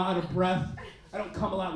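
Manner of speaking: ordinary talk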